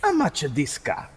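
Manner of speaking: put-on ethnic Italian customer; high-starting mock Italian accent. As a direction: do a comic Italian car buyer. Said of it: "I'll do an Italian one".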